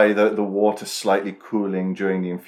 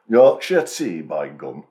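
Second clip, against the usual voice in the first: Yorkshire accent; 4 semitones lower, 5 dB louder, slower than usual. Shown as a demonstration. Showing co-host his poor Yorkshire accent, hammed-up Yorkshire. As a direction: ham up Yorkshire, then sink on the tail. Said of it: "I can't do a Yorkshire accent".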